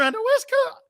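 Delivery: high caricature radio voice; pitchy, squeaky. Shown as a demonstration. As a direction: slide up into a mock West Coast squeak. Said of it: "It's really pitchy and squeaky".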